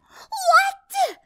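very high, shocked screech. A narrator's reading. Rainbow hearing the bad news, screeching. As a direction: screech it in outraged disbelief. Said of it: "Rainbow screeched".

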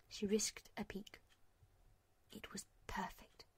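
unvoiced hushed whisper. A narrator's reading. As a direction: whisper it in awe. Hushed wonder.

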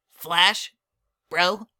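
put-on high, bouncy kid voice; young colt voice. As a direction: play an eager little colt. Child character voice.

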